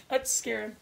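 usual speaking voice

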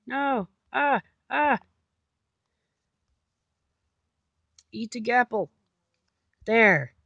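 loud frantic pleading; frantic shout. Real, not acted. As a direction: yell in panic mid-fight.

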